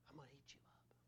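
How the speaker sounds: imitated whisper of a doting parent; whispered baby talk. Churchgoer cooing to baby, imitated.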